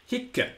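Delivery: hic high, short i, double c released; doubled final consonant. Shown as a demonstration. Teacher giving hic as it was heard, doubled final consonant. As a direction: say hic as "hikk-uh". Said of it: "pronunciation as it was heard".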